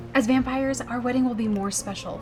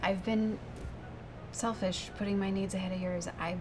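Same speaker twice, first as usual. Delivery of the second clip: contrite apology; 3 semitones lower, 6 dB quieter than usual. Acted. Girlfriend gives in and apologizes.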